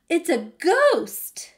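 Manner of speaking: high, loud, drawn-out alarm; acted scare, stretching out the words; fearful cry. A narrator's reading. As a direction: cry out in storybook fright. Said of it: "cried out in fear".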